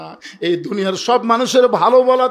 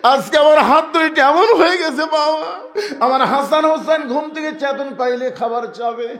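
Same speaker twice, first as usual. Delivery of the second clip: high, loud wailing plea; acted tearful plea; 9 semitones higher, 3 dB louder than usual. Fatema showing her hands to her father, tearful plea. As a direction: plead to a father through a put-on cry. Preacher voices daughter pleading with father.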